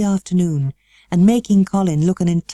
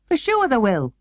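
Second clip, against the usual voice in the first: stoutly; 7 semitones higher than usual. Acted. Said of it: "he said stoutly".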